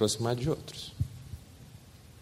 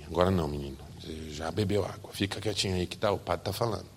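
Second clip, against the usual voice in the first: lower, softer stern mother; mother hushing; 5 semitones lower, 9 dB quieter than usual. Acted. Mother scolding son in church, lower and softer. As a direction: shush him without making a scene.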